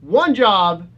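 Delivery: loud, furious rant at a blunder; mock angry yell. Host yelling at Sony for botching the job, mock furious. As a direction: yell it like the company wrecked everything.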